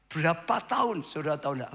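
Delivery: normal talking voice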